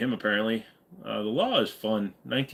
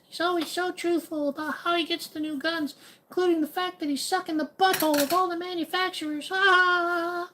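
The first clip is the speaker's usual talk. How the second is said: mock him in a tiny squeaky voice. Streamer mocking a gun tuber, high squeaky falsetto with fake laughs.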